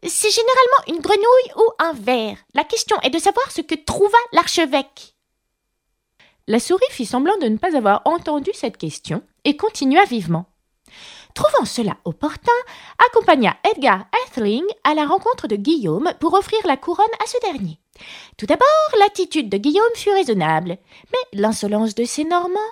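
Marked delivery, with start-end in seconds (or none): 0.1-5.1 high, insistent duck voice; pitched-up, opinionated quacker; argumentative duck. A narrator's reading. Duck argues with the mouse. 11.3-22.6 high, pompous mouse voice; pitched-up little lecturer; mouse resuming lecture. A narrator's reading.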